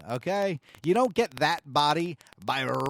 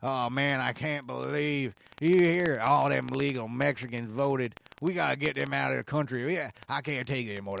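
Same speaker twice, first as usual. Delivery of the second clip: put-on Southern accent; 4 semitones lower than usual. Host imitates believers griping about illegal voters, Southern accent. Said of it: "I'm doing a Southern accent".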